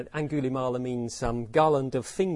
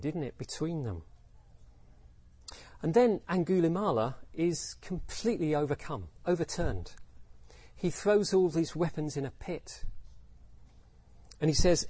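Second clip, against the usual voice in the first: ordinary delivery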